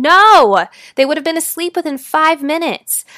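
sudden loud, high "no!"; emphatic outburst. Shown as a demonstration. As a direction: break out of the drone with a booming no. Snaps out of dull demo.

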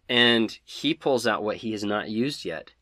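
ordinary talk, nothing marked

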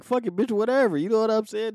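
a touch of the friend's laughing go-ahead; amused agreeing. Retold or imitated. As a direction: shrug it off while cracking up.

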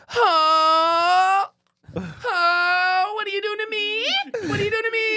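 high crying-out moan, over the top; porn actress voice. Retold or imitated. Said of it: "that's not how anybody talks or sounds".

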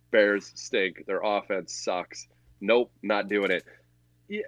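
ordinary talk

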